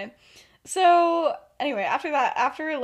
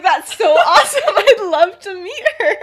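high, loud, giggling delivery; can barely get the words out for laughing; quoting through real laughter; laughing through the quote; 10 semitones higher, 13 dB louder than usual. Real, not acted. [name] voiced, laughing while quoting.

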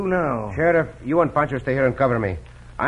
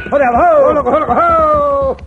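loud, high drawn-out ho to the horse; calling out to horse; 10 semitones higher, 8 dB louder, much slower than usual. Acted. Rider reins in his horse near the ranch, loud calling. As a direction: haul the horse up with long loud calls.

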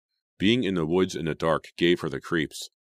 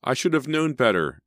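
high, loud anguished cry; 9 semitones higher, 6 dB louder than usual. A narrator's reading. Emma fighting Jason for the shovel, crying out. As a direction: cry it out while wrestling. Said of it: "Emma cried".

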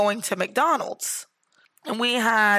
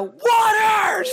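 high, loud drive-through yell; mock-exasperated shout, pitched way up; 12 semitones higher, 6 dB louder than usual. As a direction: holler it at the order window. Retold yelling at drive-through window.

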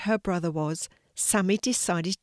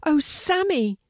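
much higher exclamation of relief; relieved shout; 9 semitones higher, 4 dB louder than usual. A narrator's reading. Sidney seeing his sister awake, relieved cry. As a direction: exclaim it high, moderately raised. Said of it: "he shouted".

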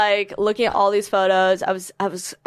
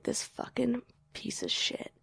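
slow, hissed whisper of rage; whispered fury; 5 semitones higher, 13 dB quieter, slower than usual. Retold or imitated. Furious at the boyfriend's silence, whispered. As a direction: seethe under your breath.

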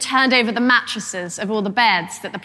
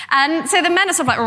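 ordinary talk

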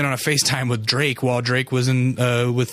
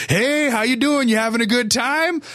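hammed-up sociable greeting, pitched way up; chatty dad greeting strangers; 11 semitones higher than usual. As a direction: imitate an overfriendly dad hailing passersby. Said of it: "we'll walk by people and he'll go".

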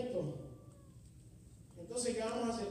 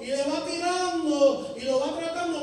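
full-force preaching; 6 semitones higher, 13 dB louder than usual. Acted. Preacher urging the youth, at full force. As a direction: drive it home to the congregation.

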